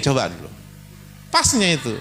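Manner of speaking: ordinary delivery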